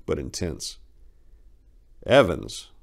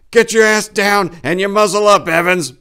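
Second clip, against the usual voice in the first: much louder, higher battlefield shout; 13 dB louder than usual. A narrator's reading. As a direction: yell it over gunfire at Evans. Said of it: "Mitchell shouted".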